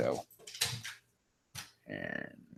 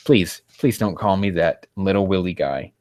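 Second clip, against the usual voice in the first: ordinary delivery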